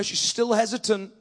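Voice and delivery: usual speaking voice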